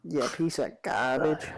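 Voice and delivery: put-on slow, lightly done Jersey accent; a hint of a Jersey tough guy, drawn out. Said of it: "That's Jersey".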